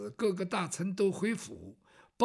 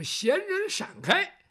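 high, loud, drawn-out road-clearing cry; herald calling out; 10 semitones higher, 5 dB louder, slower than usual. A narrator's reading. Herald ahead of the procession orders idlers aside, calling loudly. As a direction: call it out long and high to the crowd. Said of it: "有人在前边喊着".

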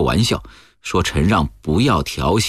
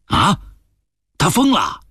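high-pitched burst of disbelief; shocked outcry; 13 semitones higher than usual. Acted. Man hearing a huge buyout price, shocked outcry. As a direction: exclaim in alarm, pitch leaping up.

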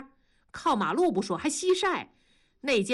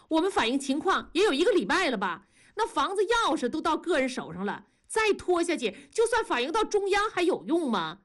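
high, pressing complaint; insistent demand; 7 semitones higher, faster than usual. A narrator's reading. Wife blocks the chairman's way demanding answers, insistent demand. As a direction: press hard for an answer, pitched up.

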